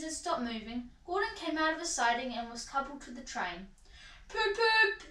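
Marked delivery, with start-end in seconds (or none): 4.3-5.0 high voiced toots; engine whistle toots. A narrator's reading. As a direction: imitate a train about to leave. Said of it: "he whistled".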